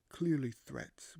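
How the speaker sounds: clearly threats, drawn out and pressed harder; stressed and slowed. Acted. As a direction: lean on clearly threats and slow right down. Uneasy king muttering about nobles' threats.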